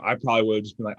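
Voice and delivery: ordinary delivery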